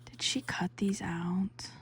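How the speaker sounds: ordinary delivery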